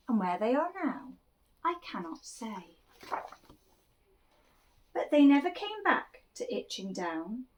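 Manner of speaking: put-on lively read-aloud for children; animated picture-book reading; storytelling voice. A narrator's reading. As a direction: tell it like a bedtime picture book.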